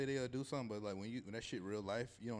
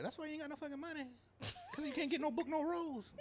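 high mocking mother voice, much higher; mock mama rant; 13 semitones higher than usual. Imitating Keke's mom ranting at her son. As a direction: ham up the angry mother. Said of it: "That's why she was like".

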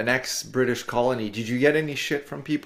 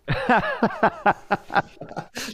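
nervous laugh; 11 semitones higher than usual. Real, not acted. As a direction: laugh off the awkward moment. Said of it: "That was the sound of getting canceled".

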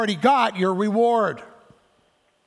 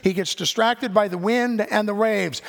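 normal talking voice

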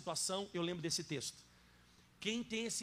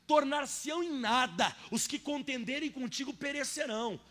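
loud, higher, slowed emphatic preaching; preaching at full force; 4 semitones higher, 6 dB louder than usual. Acted. Pastor to congregation, loud fervent preaching. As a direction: hammer each promise home.

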